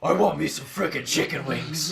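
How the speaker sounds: loud growled order; Batman. Acted. Ordering wings at Frickers, loud Batman growl. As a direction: growl the food order like a masked vigilante. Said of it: "in your Batman voice".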